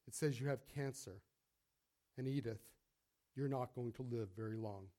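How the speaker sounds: soft, low, sorrowful doctor; gently. Acted. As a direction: break the bad news softly and low. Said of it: "Doctor Phillips said gently".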